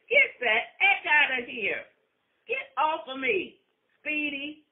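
loud, high angry yell; angry shout. Acted. Wife throwing off her fast lover, an angry shout. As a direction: shove him off with a yell.

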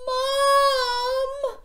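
high, loud cry for Mum; young shark crying out for his mum; yelled. A narrator's reading. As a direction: call out in panic. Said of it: "yelled Gilbert".

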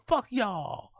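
high-pitched put-on American accent. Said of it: "do my best American accent".

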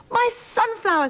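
high-pitched delighted surprise; astonished. Acted. King astonished to see sunflower.

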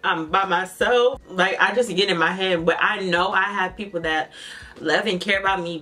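usual speaking voice

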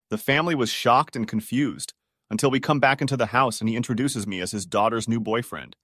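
plain reading voice

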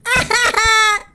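high yells mixed with laughs; half-laughing screams; laughing panic yells. Real, not acted. Gamer stuck and losing, high laughing panic yells.

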